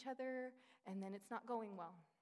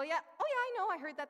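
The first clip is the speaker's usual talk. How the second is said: higher, louder, gushing "yeah, I know"; mock eager churchgoer; 8 semitones higher, 8 dB louder, faster than usual. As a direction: parody someone nodding along too eagerly. Mocking churchgoer's easy agreement.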